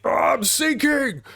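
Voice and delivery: high, startled cry of a sinking man; alarmed cry. Acted. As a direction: squeal it out as you go under. Character cries out while sinking.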